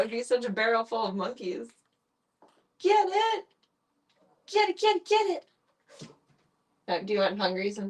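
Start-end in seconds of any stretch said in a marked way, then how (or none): 2.8-5.5 high, loud pet cheer; excited egging on. Real, not acted. Egging the cat on as it plays, excited and high. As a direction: urge the cat on, thrilled.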